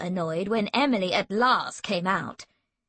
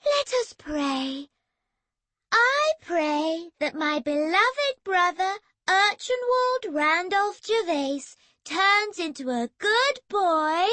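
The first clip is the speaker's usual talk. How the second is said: high, slow, holier-than-thou praying; mock pious prayer; 9 semitones higher, slower than usual. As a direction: pray over him with sanctimonious sweetness.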